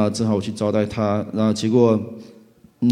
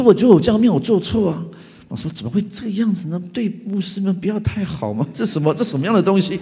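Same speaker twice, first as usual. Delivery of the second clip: indignant protest; 8 semitones higher than usual. Retold or imitated.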